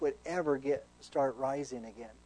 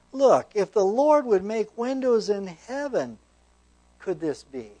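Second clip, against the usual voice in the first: slow, louder, higher mocking disbelief; scoffing officer; 6 semitones higher, 10 dB louder than usual. King's right-hand man scoffs at Elisha, slow and mocking. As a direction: sneer at the prophet's promise. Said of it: "here he just is scoffing".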